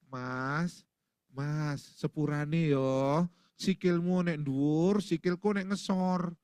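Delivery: slow, drawn-out gentle Javanese apology; mild, courteous Javanese complaint, vowels stretched; polite Javanese. Acted. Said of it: "Orang Jawa itu ya kalau diinjak".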